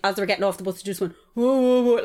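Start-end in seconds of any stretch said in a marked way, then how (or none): 1.3-2.0 imitated jeering hoots, higher and louder; mocking mouth noises. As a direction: hoot like teenagers jeering. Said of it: "making noises with their mouths".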